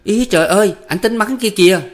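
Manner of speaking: high, loud cry of alarm; panicked cry. A narrator's reading. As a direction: cry out a frantic warning. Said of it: "la quảng lên".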